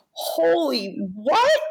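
high, slow, drawn-out holy what; retold shock at the news, pitch way up; stunned disbelief. Hearing the Oscar nomination on the phone, stunned high cry. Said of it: "And I went".

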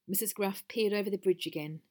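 ordinary delivery

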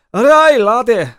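loud, high Scottish holler; strong Scottish accent. Acted. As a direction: belt it out in a broad, drawn-out Scottish accent. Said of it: "it's a very strong accent".

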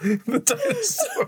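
very high, slow shrieking dinosaur call; pterodactyl shriek. Pretend. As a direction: screech like a pterodactyl. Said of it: "make the loudest noise you can".

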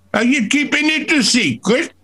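high-pitched yell from the back of the stalls; producer's yell. Retold or imitated. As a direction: call out sharply high, imitating a heckling producer. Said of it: "he used to yell".

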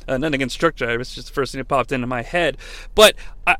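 ordinary delivery

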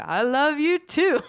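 put-on slow, loud, high botched British accent sounding Southern. Star answering screaming fans mid-concert, accidental Southern accent. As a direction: call out a drawn-out reply in a failed British accent turned Southern. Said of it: "sounds like he's from the South".

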